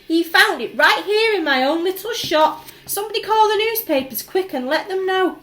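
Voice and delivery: loud, high excited yell. A narrator's reading. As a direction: shout for the newspapers, overjoyed. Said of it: "he yelled".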